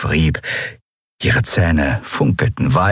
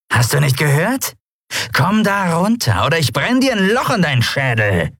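harsh, menacing threat; threatening pirate; 10 semitones higher than usual. A narrator's reading. Catlike pirate threatens the frozen boy, menacing. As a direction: threaten him coldly.

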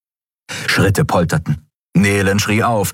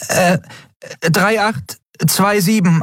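high, slow little voice; small high-pitched, halting answer; hesitant Nelen; 13 semitones higher, slower than usual. Acted. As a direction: recite the digits high, feeling for them. Nelen hesitantly recites the combination.

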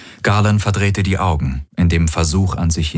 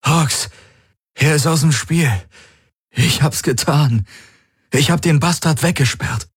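elated; 8 semitones higher than usual. Acted. Freed man announcing his victory.

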